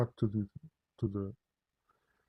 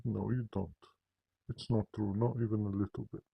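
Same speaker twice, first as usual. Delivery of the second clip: usual speaking voice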